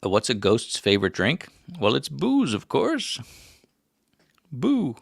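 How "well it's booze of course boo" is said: high, playful punchline delivery; joke punchline. Pretend. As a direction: land the corny ghost joke up high.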